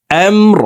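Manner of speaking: আমর ending on a silent, stopped r; stopped final r. Shown as a demonstration. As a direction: cut আমর off on the r. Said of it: "র কে ওয়াকফের ক্ষেত্রে সাকিন করে ফেলব".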